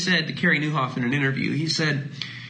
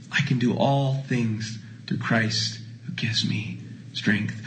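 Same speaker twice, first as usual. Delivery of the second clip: slow, breathy, lower; a hushed, slow recitation; whispered verse; 5 semitones lower, slower than usual. Acted.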